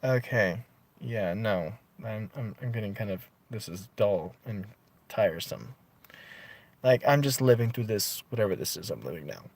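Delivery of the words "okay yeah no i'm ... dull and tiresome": much softer, lower and bored; bored dismissal. Retold or imitated. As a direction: let it sag as you lose interest. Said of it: "I'm like".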